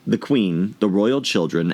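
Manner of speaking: ordinary delivery, nothing marked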